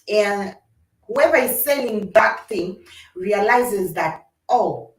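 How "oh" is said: ordinary delivery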